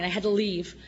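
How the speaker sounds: normal talking voice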